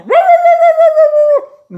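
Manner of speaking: very high, loud rolling dog yowl; dog barking. Acted. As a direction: bark wildly like a dog chasing crows. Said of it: "and the dog go".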